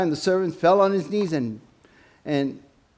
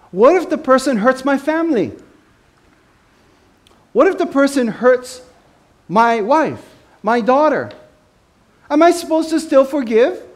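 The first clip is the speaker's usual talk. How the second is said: indignant protest, high and loud; protective attitude; 6 semitones higher, 5 dB louder than usual. Acted. Believer balking at forgiving whoever hurts his family, protective attitude. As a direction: push each question higher and louder, protesting. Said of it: "You get this attitude".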